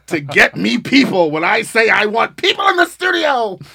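loud high hype; excited shout. Real, not acted. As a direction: shout it out playfully. Hype shout about threat.

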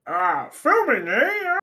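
terrible comic frog impression, drawn out with a swooping high tail; badly faked froggy presidential voice, stretched slowly; Kermit Obama. Crashing the filming as a frog president, terrible drawn-out impression. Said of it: "Kermit Obama impression ... that was a terrible impression".

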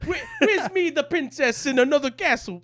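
high, loud hammed-up Jamaican accent; a bad Jamaican accent, laughing through it; terrible Jamaican accent. Riffing on Mario in a terrible Jamaican accent. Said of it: "that was terrible Jamaican accent".

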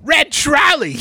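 high, loud silly holler; shouted catchphrase. Pretend. Host breaks into the closing chat, high shouted catchphrase. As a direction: yell it out as a goofy sign-off.

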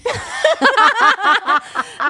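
high, loud burst of laughter; cracking up at the story; loud laughing fit. Real, not acted. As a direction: laugh hard and long. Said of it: "Estoy llorando".

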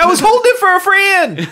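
put-on high, giggly kid's excuse; guilty kid voice. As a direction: squeak out a lame excuse. Playing caught kid excuse.

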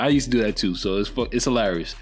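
ordinary talk

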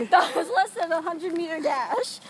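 laughing through the words. Real, not acted. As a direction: giggle while saying it. Laughing at themselves.

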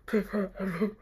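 a hint of a creepy, breathy Esme; lightly voiced eerie mom, urging him on; mild creepy Esme voice. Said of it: "just how I imagine Esme".

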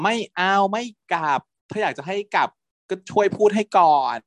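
high, whiny sulking voice; a bit of a pouting teen; sulky whine. Retold or imitated. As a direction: sulk like a spoiled kid. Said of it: "งอนงอแง".

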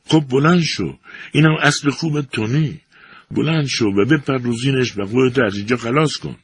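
high, pressing cry; shouting. A narrator's reading. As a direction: urge him up onto the saddle. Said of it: "فرانکی با فریاد گفت".

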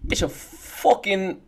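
slow, high stab at British; Aussie attempt slipping into British; drawn-out, badly faked British accent; failed British accent. Shown as a demonstration. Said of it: "I'm on the British accent".

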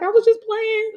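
very high imitation of a kid backtracking; retold pleading child. Mother mimicking her kid who was told to leave, high child voice. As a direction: squeak like your child taking it back.

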